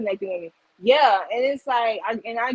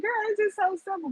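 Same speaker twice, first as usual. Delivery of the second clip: casual advice; 10 semitones higher, faster than usual. Retold or imitated. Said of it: "And I'd be like".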